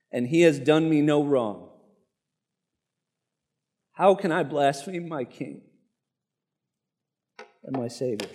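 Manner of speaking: fighting back tears as the words fade out; choking up. Real, not acted. Preacher reading Polycarp's answer to the proconsul, voice breaking with tears. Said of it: "I love crying in front of crowds".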